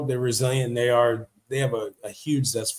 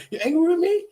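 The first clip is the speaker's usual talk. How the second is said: high, anxious pleading question; hammed-up fretful boyfriend voice; mock worried asking; faster than usual. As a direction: play it like a nervous husband checking in, pitched up. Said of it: "And I'm like".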